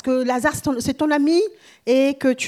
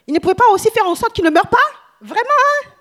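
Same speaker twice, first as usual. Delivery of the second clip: mocking; 8 semitones higher, 5 dB louder than usual. Mocking the doubting crowd.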